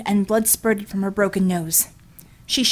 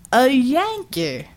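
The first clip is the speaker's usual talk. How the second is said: a brute gloating over a beaten woman; 3 semitones higher, much slower than usual. Acted. Villain's voice.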